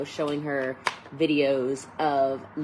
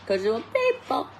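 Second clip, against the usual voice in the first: playful squeal; 11 semitones higher than usual. Real, not acted. Coach telling viewers whom she likes, playful high squeal. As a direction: let it leap up into a silly squeak.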